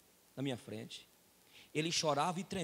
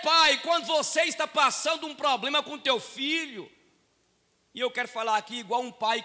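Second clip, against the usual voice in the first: raised, ringing pulpit voice, higher and louder; fiery preaching; 8 semitones higher, 9 dB louder than usual. Acted. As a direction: preach it out to the whole church. Pastor preaching at full force.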